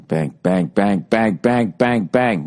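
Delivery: steady beat of bangs, climbing in pitch; imitated hammering. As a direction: chant each bang like a hammer blow, stepping upward. Imitating the hammering once more.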